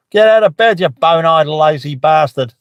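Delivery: jokey Aussie dad yelling his daughter out of bed; mock Aussie yell. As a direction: bellow it down the phone in a broad Aussie accent. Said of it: "fires back in very, very Aussie as well".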